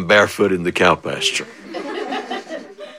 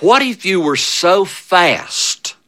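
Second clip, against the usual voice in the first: usual speaking voice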